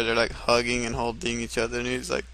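ordinary delivery

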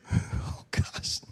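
breathless whispered dread; whispered oh gosh. Retold or imitated. A man lined up before God, whispering nervously. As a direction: mouth it in nervous fear.

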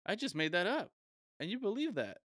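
high, loud mock gloating; older gentleman. Older man confessing his prank to a stranger, imitated high and loud. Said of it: "older gentleman".

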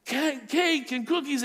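put-on high, drawn-out excited child squeal; mock squeal of delighted kids; imitated kids' screaming. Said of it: "his kids screaming and yelling about".

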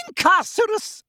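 very high, shrieked incantation; screamed spell. A narrator's reading. Umbridge casting at Magorian, screaming. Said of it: "pointed her wand at Magorian and screamed".

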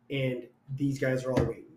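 usual speaking voice

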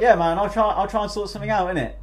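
high, loud casual mate; a lightly voiced laid-back mate. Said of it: "George is like".

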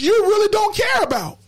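usual speaking voice

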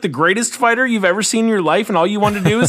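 high, fast, exasperated rant; incredulous retort. Acted. Host mocking fans' short memory of Tony, incredulous. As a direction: fire it back in rapid disbelief.

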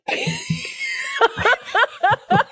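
high shrieking laugh; big laugh. Real, not acted. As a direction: burst out laughing. Laughing at prank idea.

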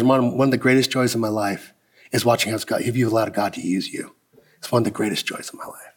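low, soft, moved; tender praise. Retold or imitated. Old mentor tells his friend how proud he is, tenderly. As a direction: speak softly like an old mentor full of pride.

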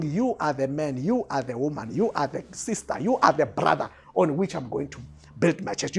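low, softened, measured pronouncement; solemn quote. Acted. Christ coming to choose each listener, solemn and low.

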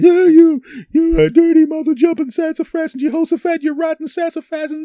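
high, loud hammed-up yelling; mock furious girlfriend. Girlfriend storming in, hammed-up shrill yelling. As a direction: scold him in a shrill mock rage. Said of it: "she comes steaming into my place".

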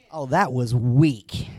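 ordinary talk

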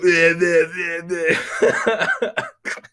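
dopey mock blabbering collapsing into a belly laugh; cartoonish nonsense syllables, then crack up; caveman gibberish and laughter. Imagined woman listener mimicking men, caveman gibberish into laughter. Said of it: "you do sound like".

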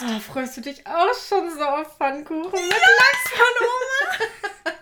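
high teasing, laughing. Real, not acted. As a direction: tease while laughing.

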